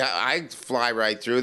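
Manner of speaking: ordinary talk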